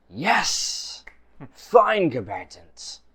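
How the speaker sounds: excited greeting. Acted. GM voices excited grandmaster.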